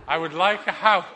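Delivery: man loudly asking the fish; man's voice, loud. Acted. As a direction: announce the wish boldly.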